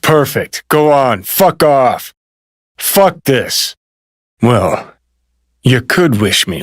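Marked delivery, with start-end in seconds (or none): 0.0-3.8 higher-pitched, fed-up cursing; bitter outburst, pitched up; angry outburst. Acted. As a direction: curse them off in frustration. Lashes out after being dismissed.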